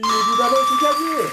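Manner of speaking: loud, high-pitched burst of triumph; triumphant confirmation. Real, not acted. Quiz host confirming the winning answer, triumphant. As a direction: cheer the win at full voice.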